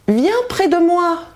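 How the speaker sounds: high, coaxing false grandmother; disguised wolf calling the girl over; wolf posing as grandmother. A narrator's reading. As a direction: lure her closer, sweetly.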